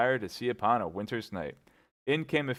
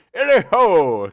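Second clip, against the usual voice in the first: huge, much louder and higher hunting cry; jovial whoop; 10 semitones higher, 17 dB louder, slower than usual. A narrator's reading. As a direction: whoop it out for joy. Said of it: "cried Fezziwig".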